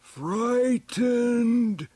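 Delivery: very high, loud, drawn-out cry; creature's thought. Acted. Stegosaurus sends its fear to Tila, a high loud cry. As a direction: call it out like a huge beast's thought. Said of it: "Tila caught its message".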